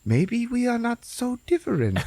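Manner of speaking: high, drawn-out character voice; hammed-up high reconciliation; mock heartfelt realization. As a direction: lift it high in dawning wonder. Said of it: "they're like".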